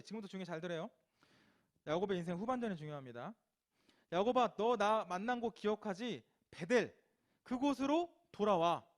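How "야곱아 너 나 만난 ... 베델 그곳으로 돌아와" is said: loud calling out. Acted. God calling Jacob back.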